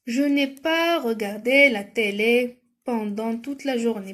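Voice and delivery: very slow, word by word; careful, drawn-out classroom reading; slow dictation reading. Shown as a demonstration. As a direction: read the sentence out for pupils to follow. Reads exercise sentence for spelling.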